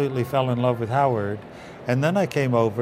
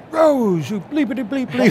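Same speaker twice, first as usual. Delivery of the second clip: high, loud mock scream; imitated furious director; 11 semitones higher, 3 dB louder than usual. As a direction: throw the director's old yell back at him as a joke. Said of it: "And he goes".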